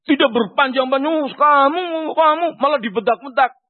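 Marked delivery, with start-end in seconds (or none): 1.3-2.5 higher, pointed mock scolding. Preacher mocks a long-winded advice giver, higher and scolding. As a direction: wag a finger at someone.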